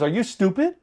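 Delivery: incredulous coach. Retold or imitated. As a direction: throw the question back hard, amazed. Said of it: "He goes".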